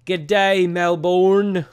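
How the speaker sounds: hammed-up Australian G'day, loud and drawn out; loud mock Australian greeting; Australian accent. Shown as a demonstration. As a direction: greet them like a mock Aussie host. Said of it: "I can't do Australian accent".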